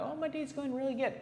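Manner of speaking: high, sing-song mock cheer; exaggerated bright falsetto small talk; mock chipper. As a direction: chirp it like a fake-happy shop clerk. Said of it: "being like".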